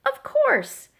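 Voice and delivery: high, loud delight; eager exclamation. A narrator's reading. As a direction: burst out with eager agreement. Thistle eagerly takes up the game.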